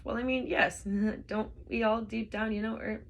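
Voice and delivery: laughing nervously through the words; an awkward, uneasy chuckle. Acted. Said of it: "Ford laughed a nervous laugh".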